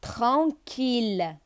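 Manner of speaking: tranquille stretched out slowly; tran-quille, said slowly and clearly; slow syllable demo. Shown as a demonstration. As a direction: spell out tranquille with your voice for pupils. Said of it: "teacher models a word".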